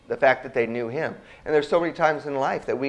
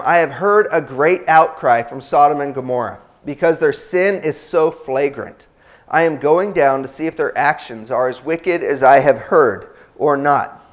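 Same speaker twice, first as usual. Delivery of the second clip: louder, bold Bible-reading voice; scripture reading; 6 dB louder than usual. Acted. Pastor reading Abraham's plea to his congregation, bold scripture reading. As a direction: proclaim the story to the congregation. Reads Genesis passage aloud.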